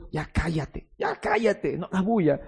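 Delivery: softly acted shushing; hushing disciples. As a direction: hush someone under your voice, imitating annoyed bystanders. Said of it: "Y los discípulos".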